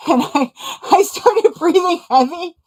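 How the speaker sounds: high, loud nervous laughter breaking through; laughing while telling. Real, not acted. Telling a friend about the panic attack, laughing. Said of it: "I'm laughing because I have to now".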